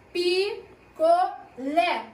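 "Picolé" very slowly as Pi, co, LÉ, each part separate; syllable by syllable. Shown as a demonstration. Teacher asks pupils for the strongest syllable, "Picolé" slowly broken up. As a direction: draw out "Picolé" in three beats, landing firmly on LÉ. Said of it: "Qual é a mais forte?".

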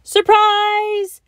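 very high, loud surprise shout; husband jumping up with a yell. A narrator's reading. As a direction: burst out yelling surprise. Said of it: "Mister Bear shouted".

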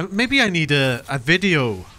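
ordinary talk, nothing marked

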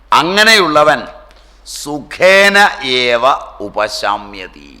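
slow, swelling preacher's delivery with drawn-out Sanskrit words; scripture exposition. Acted. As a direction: proclaim each phrase slowly and loudly, letting it ring. Expounds scripture with full force.

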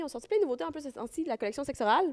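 usual speaking voice

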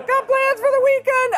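put-on high voice. Same high voice.

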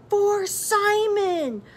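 high, long drawn-out battle cry. A narrator's reading. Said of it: "Margaret cried".

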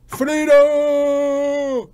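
long, high battle cry in mock Scottish; William Wallace. Wallace rallying against the English, drawn-out shouted cry. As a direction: bellow it and hold the last vowel. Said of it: "William Wallace there for a second".

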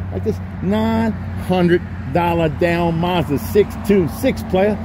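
slow, stretched price announcement; drawn-out sales pitch. Acted. Dealer slowly pitching the Mazda price to viewers, drawn out.

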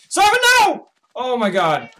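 loud, high cry of alarm; alarmed shout. Real, not acted. Player seeing friend Simon threatened, alarmed shout. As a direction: shout out in sudden panic.

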